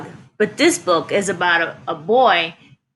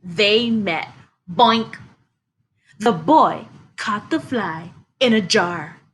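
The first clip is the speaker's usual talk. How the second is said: usual speaking voice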